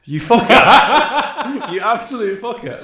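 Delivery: loud, laughing real shout of outrage; laughing outburst. Real, not acted. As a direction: yell it while cracking up at the prank. Caught out by a setup.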